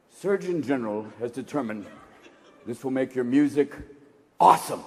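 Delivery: low mock-official announcement; official warning read. Surgeon General's warning on a box of joints, low official read. Reading out imagined warning label.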